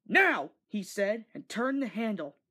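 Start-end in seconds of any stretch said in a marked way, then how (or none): 0.1-0.5 higher, louder sharp command. A narrator's reading. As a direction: call the signal hard. Driver gives the signal.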